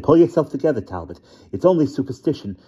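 cling to science in terror; the terrified scientist pleading with the transforming werewolf, panicked and high. A narrator's reading.

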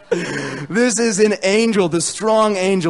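loud reading riding on a laugh. Real, not acted. Preacher returns to the verse after joking, laughing. Preacher cracked up by his own joke.

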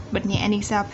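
ordinary talk

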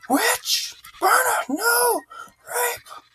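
very high shrieked outbursts; high-pitched hysterical yelling; mock hysterical mob. Mock panic at game enemy.

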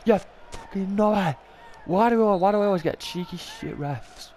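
high, bitter insulting rant; cursing the ref. Real, not acted. Gamer cursing the referee after a bad call, bitter high rant. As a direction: vent pure contempt at the ref.

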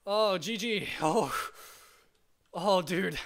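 shaky after a close fight; breathless relief, still trembling; adrenaline shakes. Real, not acted. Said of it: "Oh, my hands are shaking".

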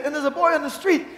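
ordinary talk, nothing marked

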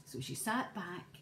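ordinary delivery

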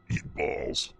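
deep demon voice filter on "Eat balls"; demon voice changer. Shown as a demonstration. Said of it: "Is it, like, demon deep".

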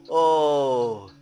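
very loud high shriek; excited scream. Real, not acted. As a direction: shout in shock. Big reaction in game.

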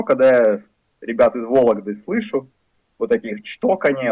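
normal talking voice

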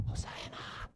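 tiny drawn-out whisper; mock whispered cheer. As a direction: whisper the cheer as if afraid to startle. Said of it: "those who followed whispered quietly".

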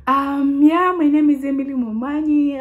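high, stretched-out self-introduction; imitating how she used to speak; former way of speaking. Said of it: "This is how I used to speak".

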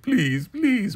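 very high, whimpery imitated begging; mock pleading. Manny begging the elder mother for care, mock pleading. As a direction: beg in an exaggerated high voice.

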